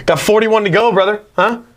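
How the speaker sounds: high, teasing pet voice; talking to the dog. Real, not acted. Joking to the dog.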